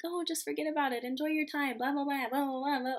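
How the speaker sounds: high, sing-song parody of well-meaning advice; mock cheerful advice trailing into blah blah; mocking others' advice. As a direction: mimic everyone's breezy reassurance dismissively. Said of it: "everybody was like".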